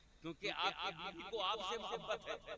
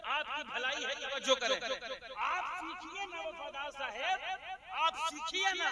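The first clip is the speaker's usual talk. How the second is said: much higher and louder through laughter; laughing tease; 10 semitones higher, 9 dB louder than usual. Real, not acted. Preacher laughing as he teases Wafadar Sahab. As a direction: tease him while laughing.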